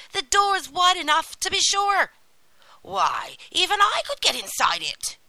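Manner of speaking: high, loud witch voice; scolding witch. A narrator's reading. Witch shows Gretel the oven door, loudly impatient. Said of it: "said the witch".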